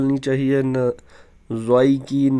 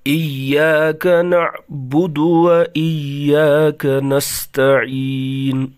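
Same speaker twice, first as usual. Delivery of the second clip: slow, melodic tajweed recitation; Quran recitation; much slower than usual. Shown as a demonstration. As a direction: chant each verse evenly, drawing out the vowels. Teacher recites Fatiha verse by verse.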